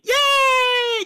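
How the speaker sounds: squealed loud yay; high squeaky party cheer. Acted. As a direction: celebrate with a shrill party yay. Confetti cheer from the imp.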